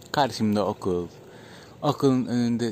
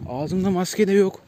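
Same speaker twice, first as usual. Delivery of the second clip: usual speaking voice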